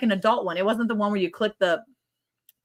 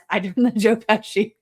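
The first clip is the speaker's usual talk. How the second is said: normal talking voice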